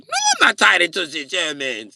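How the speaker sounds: high, loud mock German protest, falling at the end; loud denial, German accent. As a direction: deny it loudly in a German accent. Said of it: "Why are you doing the German accent".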